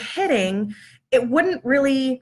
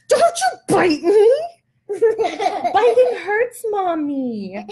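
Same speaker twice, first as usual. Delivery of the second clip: high, loud, laughing scold; playful scolding; 9 semitones higher, 3 dB louder, much slower than usual. Real, not acted. Mom scolding the toddler biting her hand, laughing. Child bites mom's hand.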